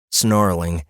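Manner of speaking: ordinary delivery, nothing marked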